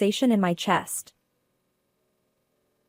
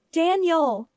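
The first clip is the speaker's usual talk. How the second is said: calling out; 7 semitones higher than usual. A narrator's reading. Sister to her injured brother on the road, calling out. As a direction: call his name in alarm. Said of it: "I called out to him repeatedly".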